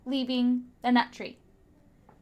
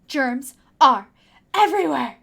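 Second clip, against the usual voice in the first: louder, urgent warning; 8 dB louder than usual. Acted. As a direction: press the warning hard. Warning in exit plan.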